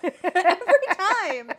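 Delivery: laughing the words out slowly; a real laugh through every time. Real, not acted. Laughing about the partner always stealing her thunder.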